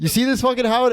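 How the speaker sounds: high-pitched mock hollering; a hint of a raucous table yell; screaming gambler. Gambler crowing to Howard, mock yelling. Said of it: "It's just me screaming".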